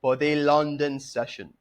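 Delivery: put-on British accent, slow and clear; a mock British presenter's welcome. YouTube trader greeting viewers in his best British accent. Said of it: "That is my best British accent".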